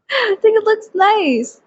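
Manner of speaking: high, loud, slow laughing approval; giggle, pleased with yourself; slow amused high praise. Real, not acted. Laughs pleased at her look.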